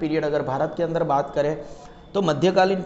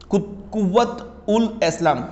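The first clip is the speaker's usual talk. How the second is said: slow, halting and higher; struggling with Urdu; 4 semitones higher, slower than usual. Real, not acted. Teacher attempting a difficult Urdu name, slow and halting. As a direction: stumble at the start, then place each word carefully. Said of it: "Urdu word बोलने में थोड़ी तकलीफ होता".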